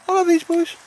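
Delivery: very high, loud squeaky pup voice; dog's voice. Pretend. Owner voicing his dog Baldrick to Al, high squeaky dog voice. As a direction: speak for the dog in a squeaky falsetto.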